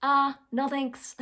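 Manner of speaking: drawn-out, unbothered no thanks; slow calm refusal, said again without budging; slow deadpan refusal. Shown as a demonstration. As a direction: repeat it slowly and flatly as a broken record. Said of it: "all you have to say is".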